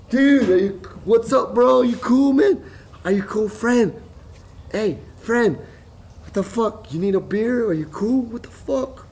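high, loud check on a downed friend; urgent calling out. Retold or imitated. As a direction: call out in a high, alarmed pitch.

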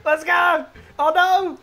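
high, loud cheer turning to dismay; excited shout. Real, not acted. Driver hooks the car then loses it, excited shouting. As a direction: yell it out, pitched well up. Hook catches, then breaks.

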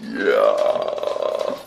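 very low, drawn-out yeah; deep low voice. Acted. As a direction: drop way down low and stretch it.

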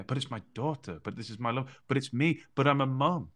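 insistent, rising pleas with hard-hit words; protesting anxious parent. Acted. Parent defending worry over their daughter, urgent protest.

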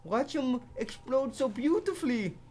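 lightly voiced high German accent; playful German accent quote; mock German accent. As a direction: quote the German guy in a hint of his accent. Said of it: "But the German guy said".

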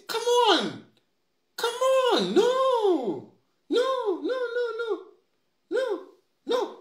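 anguished, crying protest; exasperated wail. Real, not acted. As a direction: wail it out in despair at the chamber. Exasperated plea to parliament.

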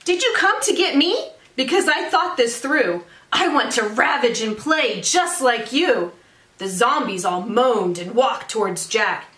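lively, singsong storytelling; picture-book read-aloud. A narrator's reading. Teacher reads a zombie picture book to families, animated read-aloud. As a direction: read it to children with rhythmic emphasis.

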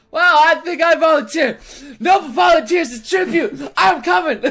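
high, loud joking yell of volunteering; excited shout. Real, not acted. Streamer jokes at the game, loud laughing shout.

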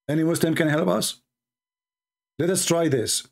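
normal talking voice

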